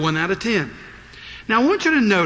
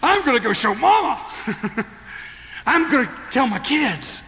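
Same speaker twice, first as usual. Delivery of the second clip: eager, high and bright-voiced plans; a healed person bursting to share the news; excited; 7 semitones higher than usual. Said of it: "what's the first thing you want to do".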